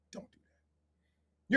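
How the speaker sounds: slow, drawn-out whisper; whispered warning. Acted. Telling parents he's doing nothing to them, whispered warning. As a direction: whisper it slowly as a caution.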